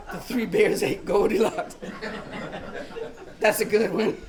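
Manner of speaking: words broken by laughter; laughing father. Retold or imitated. Father cracks up at his daughter's joke, laughing. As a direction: laugh through it helplessly. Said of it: "his shoulders started shaking".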